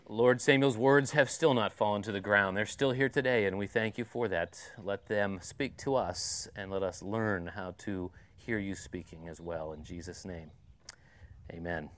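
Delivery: prayer. Acted. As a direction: pray gently, fading into the amen. Leads a closing prayer.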